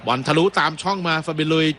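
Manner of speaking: rising excitement as the shot comes; live play-by-play. Real, not acted. Commentator calling a shot that gets blocked, excited play-by-play. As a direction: build the attack up to a high-pitched shot call.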